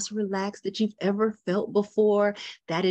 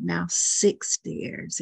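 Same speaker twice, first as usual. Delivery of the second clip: ordinary delivery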